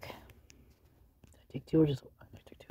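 soft, unvoiced aside; whispering. Real, not acted. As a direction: whisper it to yourself. Aside about inhaler dose.

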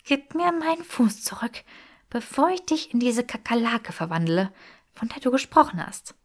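höher, gepresste Drohung; verhalten wütend. A narrator's reading. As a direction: Granger droht Draco mit verhaltenem Zorn. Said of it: "schnaubte Granger mit zusammengebissenen Zähnen". (gepresst und höher drohen)

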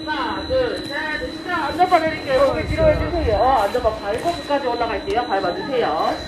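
usual speaking voice

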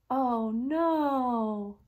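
long drawn-out storyteller's dismay; mock dismay. Reader reacts to troll's threat, mock dismay. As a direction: stretch it out in suspense.

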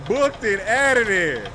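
loud, high, drawn-out cry; exultant holler. Real, not acted. Player just got out of there, hollering. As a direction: stretch it out in a big holler.

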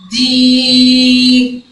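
دي with a long, level ee; drawn-out دييي; strongly stretched vowel. Shown as a demonstration. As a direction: hold the ee of دي on one steady note. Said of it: "مديتي قوي وكسرتي".

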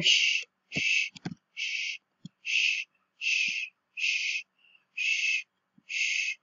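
whispered shh; soft repeated shushing. Real, not acted. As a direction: hush everyone with whispered shushes. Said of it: "Guys, shush".

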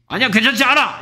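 high-pitched mock protest; blunt refusal. Shown as a demonstration. Teacher mimics a rude refusal, high-pitched blunt protest. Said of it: "이렇게 거절하진 않겠죠".

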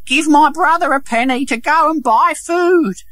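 high, pitiful put-on sick voice; feigned weakness, pitched high; pleading beggar.

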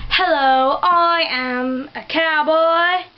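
slow, drawn-out mock English accent; hammed-up English-accented cowboy. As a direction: introduce yourself in a put-on English accent. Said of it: "an English accent".

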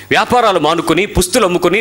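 ordinary talk

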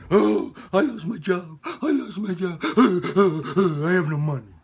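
high, loud mock wailing; mocking his son. Father mimicking his jobless son's complaints, mock crying. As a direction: whine it like a self-pitying sufferer. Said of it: "Being all self-important".

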